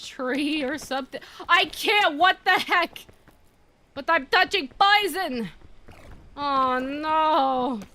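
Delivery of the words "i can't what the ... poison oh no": frantic. Real, not acted. Stuck on poison, frantic.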